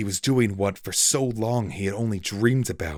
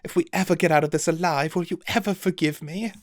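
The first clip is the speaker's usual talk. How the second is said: higher, fast, lightly whimpering plea; mild whimper; 11 semitones higher, faster than usual. A narrator's reading. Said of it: "he whimpered".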